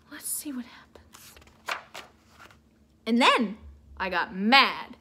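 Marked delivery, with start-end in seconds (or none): 0.1-1.3 whisper. Real, not acted. Reader whispering to the kids to keep them curious. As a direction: lean in and whisper it.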